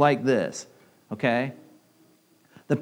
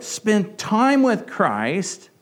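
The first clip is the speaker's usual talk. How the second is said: slow emphatic plea; 5 semitones higher, much slower than usual. Real, not acted.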